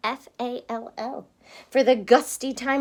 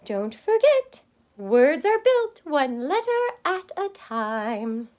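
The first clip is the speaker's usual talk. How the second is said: high, louder call, then slower; 3 semitones higher, 4 dB louder than usual. Acted. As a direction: call it after him as you leave. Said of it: "she called".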